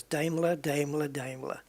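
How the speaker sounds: Daimler as DAME-ler, repeated; common mispronunciation. Shown as a demonstration. Gamer reciting a lifelong mistake, Daimler as DAME-ler. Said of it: "And it's not".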